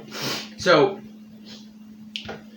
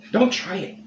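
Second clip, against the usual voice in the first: urgent warning; 7 semitones higher, slower than usual. Real, not acted. Warning viewers off chip.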